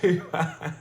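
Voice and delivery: helpless laughter into the words; amused at own word-search struggle; laughing. Real, not acted. Laughing at own memory blank.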